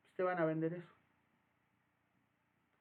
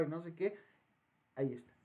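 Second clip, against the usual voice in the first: normal talking voice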